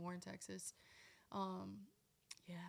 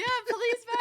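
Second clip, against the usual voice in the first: playful high, loud begging; mock sales plea; 13 dB louder than usual. Singer playing along with host's merch pitch, mock pleading loudly. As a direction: join in the sales bit, pitched way up.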